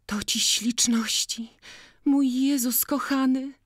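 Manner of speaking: high, breathless whisper of wonder; awed whisper. Acted. Jagna marvelling at the ribbons, whispered and high. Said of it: "szeptała przystając".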